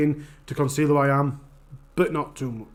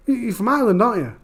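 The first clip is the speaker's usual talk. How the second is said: higher voice for a nosy local; retold pub questioner; 7 semitones higher, faster than usual. Pub local asking streamer about Ireland, higher-pitched retelling. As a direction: lightly act the stranger asking, pitched up.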